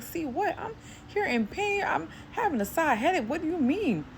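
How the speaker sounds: high-pitched, incredulous protest; retold exasperation. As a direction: plead your case in a high, baffled voice. Retold protest to spirit while in pain.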